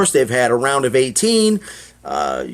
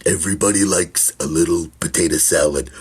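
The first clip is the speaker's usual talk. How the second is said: much lower, calm, placating tone; deep soothing voice; 9 semitones lower than usual. Retold or imitated. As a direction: talk him down like a soothing crooner. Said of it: "I says".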